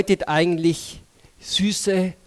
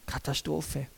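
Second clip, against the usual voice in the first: honest admission; 4 semitones lower, 12 dB quieter than usual. Retold or imitated. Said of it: "sondern du kannst sagen".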